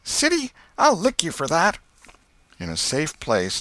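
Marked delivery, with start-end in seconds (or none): 0.0-1.8 put-on much higher, louder, threatening boy; an angry boy promising payback; older brother threatening the tattletale; Tom. A narrator's reading. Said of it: "he said".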